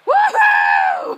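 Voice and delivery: high, loud "woo-hoo" whoop; whooping cheer. Acted. Friend imagining a jump off the rock, whooping cheer. Imitates someone cheering while jumping.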